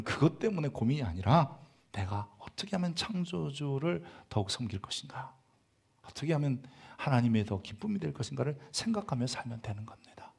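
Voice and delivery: softening down to a whispered close; earnest preaching fading to a whisper; fading to a hush. Acted. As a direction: let it die away to a whisper. Fades to whisper.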